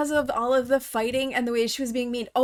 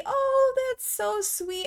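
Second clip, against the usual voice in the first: tender aww; 11 semitones higher, slower than usual. Real, not acted. Sims bonding over baby.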